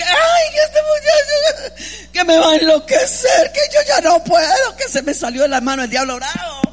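high, loud imitated wailing; hammed-up weeping mother, easing off at the end; mock crying. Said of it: "esas hermanas que se mantienen llorando".

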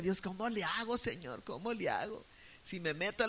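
soft, pleading inner prayer; hushed prayer. Retold or imitated. Frightened daughter praying silently during the fight, hushed. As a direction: pray under your breath, begging God.

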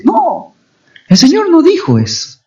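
high-pitched, emphatic rebuttal; emphatic denial. Real, not acted. Preacher correcting false ideas of salvation, emphatic denial. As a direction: cut in with a high, insistent no. Refutes the mock requirements just listed.

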